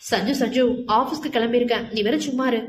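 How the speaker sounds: ordinary delivery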